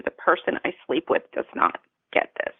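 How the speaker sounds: whispered, pained aside; near whisper. Real, not acted. Telling the host her husband didn't understand, near whisper. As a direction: let the words drop to a whisper. Emotional peak of painful story.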